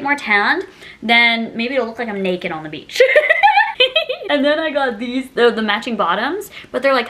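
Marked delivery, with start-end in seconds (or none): 3.1-4.2 a rising high laugh. Real, not acted. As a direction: laugh at the naked-on-the-beach joke. Laughing at own joke.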